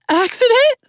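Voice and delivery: a startled high cry of disbelief; shocked high question. Acted. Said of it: "voice went a note or two higher".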